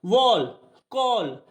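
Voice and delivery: "वॉल, कॉल" with a rounded aw vowel; o sound. Shown as a demonstration. Teacher showing rule five, rounded o in "वॉल, कॉल". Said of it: "ओ की sound भी दे रहा है".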